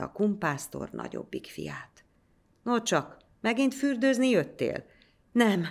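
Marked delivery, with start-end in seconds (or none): 2.6-4.8 high, mocking priest voice; teasing priest. A narrator's reading. Priest teasing the returning boy, higher and mocking. 5.3-5.7 panting. A narrator's reading. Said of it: "lihegte a gyerek".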